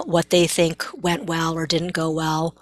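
usual speaking voice

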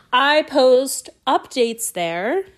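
slow, exaggerated word-by-word correction; slowed right down. Real, not acted. Podcaster correcting a stumble to listeners, very slowly. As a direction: stretch each word out deliberately. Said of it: "Let's slow it down".

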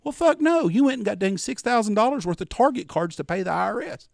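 loud, high-pitched exasperated tirade; losing it. Retold or imitated. Son scolding his gullible mom over gift cards, losing it. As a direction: blow up in disbelief, voice way up. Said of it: "Boy, I lost it shit right there".

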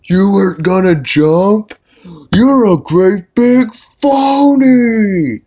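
mocking a boy on the roof, high scornful voice; Holden Caulfield. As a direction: sneer it out as a put-on snotty teen. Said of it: "Caulfield goes running up and says".

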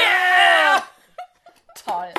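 loud, high excited cry. Real, not acted. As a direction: yell out with delight. Reacting to the massager.

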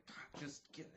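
NPC whispering irritably into his hat; hushed, annoyed mutter while rummaging; whispered grumble. Acted. Game master voicing NPC whispering to himself as he digs through his hat.

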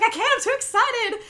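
high, loud mock giddiness; too excited. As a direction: imitate someone bursting with anticipation. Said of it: "jumps up, is like".

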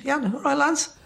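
high little Welsh voice; a small Welsh bloke saying all right lads; Alan Williams Welsh voice. Retold or imitated. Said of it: "High voice, little Welsh accent".

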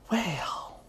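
breathy whispered "Well"; hushed conspiratorial aside; gossip whisper. Acted. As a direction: drop to a secretive whisper.

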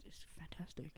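whispered aside; whispering. Real, not acted. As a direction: breathe it out under your breath. Quiet aside while planning episode.

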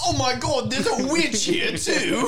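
loud, high hammed-up English shock; over-the-top yelling English accent; aggressive English. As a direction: shriek it in mock English horror. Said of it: "It's just aggressive English".

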